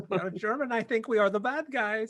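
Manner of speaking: put-on high, comic German accent; hammed-up German accent. Comic German soldier impression.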